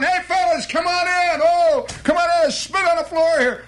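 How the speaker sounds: high, loud calling-in of customers; hollering. Acted. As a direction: owner hollers at passers-by to come inside, loud and high. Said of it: "he keeps hollering".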